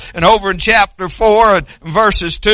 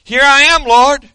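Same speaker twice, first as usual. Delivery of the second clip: raised, higher answering call; crying out; 5 semitones higher, 3 dB louder than usual. Acted. Congregation answering God's call, crying out. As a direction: call back up to the Lord. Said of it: "you need to be crying out".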